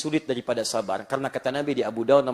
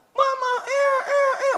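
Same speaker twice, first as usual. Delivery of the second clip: put-on high squealing baby cry for mama; 3 dB louder, slower than usual. Preacher imitating a newborn crying for mama, high wail.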